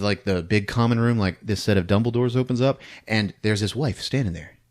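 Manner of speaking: ordinary talk, nothing marked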